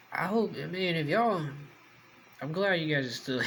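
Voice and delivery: ordinary talk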